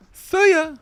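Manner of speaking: very high, loud squeaky farewell; cartoon bird calling goodbye way up high; mock goodbye. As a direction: chirp it in a comic falsetto. Ducks' goodbye, echoed comically.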